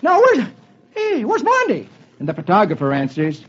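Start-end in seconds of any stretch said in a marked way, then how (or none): 0.0-1.9 high-pitched, flustered comic voice; harried cartoon husband; frantic husband. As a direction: blurt it out high and agitated. Frantic Dagwood voiced in story.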